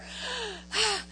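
long audible breath out; acted overwhelmed sigh; heavy sigh. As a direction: let out a big, airy sigh. Takes a breath before preaching.

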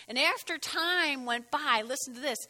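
normal talking voice

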